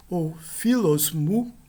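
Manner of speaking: philos mu said slowly, every syllable clear; careful Greek demo. Shown as a demonstration. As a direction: model philos mu slowly for students. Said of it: "Por exemplo". (philos mu pronunciado devagar)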